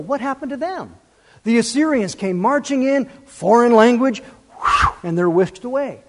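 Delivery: normal talking voice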